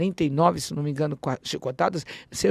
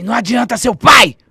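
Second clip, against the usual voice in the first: a raised, snarling threat from a dead face; higher, much louder ghost threat; menacing ghost voice; 5 semitones higher, 12 dB louder than usual. Retold or imitated. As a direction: hurl it at the child like a spirit. Ghostly creature threatens the child.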